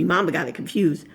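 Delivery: plain reading voice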